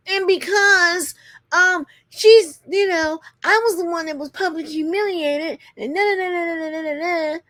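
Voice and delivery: high, put-on whiny rant; mocking complainer. Host imitating Britt complaining beside silent husband, mocking high voice. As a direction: mimic her complaint, high-pitched, running into babble.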